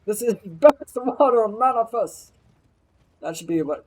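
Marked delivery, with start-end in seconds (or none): 0.0-2.3 loud, high hammed-up announcement; mock proclamation. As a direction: belt it out as a mock grand reveal.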